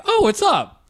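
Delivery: high, friendly mock greeting; friendly reply. Stranger answering a little kid, high and friendly.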